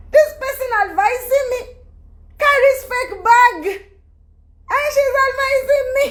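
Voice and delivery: mocking scorn. To her followers about the adviser, high mocking scorn. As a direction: sneer it up high, amused.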